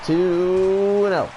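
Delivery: high, slow, stretched count; drawn-out excited count. Real, not acted. Near-fall count.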